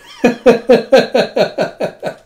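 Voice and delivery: high, loud burst of real laughter. Real, not acted. Recapping the kitty joke, real loud laugh. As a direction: crack up out loud.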